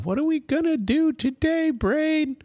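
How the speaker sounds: very high, silly cartoon sidekick voice; Pinky impression. Replaying the cartoon bit for his viewers, in a very high Pinky voice. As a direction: pipe it up like a goofy cartoon mouse. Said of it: "we'll put Pinky in the Brain".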